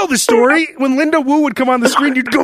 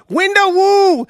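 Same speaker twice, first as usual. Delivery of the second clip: imitating a kid yelling; 6 semitones higher, slower than usual. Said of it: "you'd yell".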